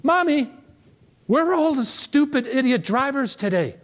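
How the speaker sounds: put-on high, whiny little kid voice; little boy. Little boy whining to his mother from the back seat, high-pitched. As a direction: pipe it up like a small boy complaining. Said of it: "the little boy who says to his mother".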